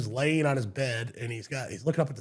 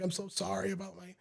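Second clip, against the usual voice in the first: soft, high, frail mock crying; hushed, pleading, lightly tearful retelling; imitating tearful apology; 5 semitones higher, 10 dB quieter than usual. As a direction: imitate a weeping old woman apologizing. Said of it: "she starts crying".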